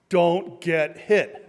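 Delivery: very slow, word by word; slow emphatic rule. Real, not acted. Speaker teaches audience boxing's first rule, slow and emphatic. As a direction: spell it out with weight on each word.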